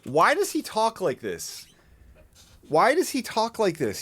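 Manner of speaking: high-pitched, incredulous complaint; high, disbelieving whine of protest; exasperated bafflement. Real, not acted. Baffled by man's way of talking.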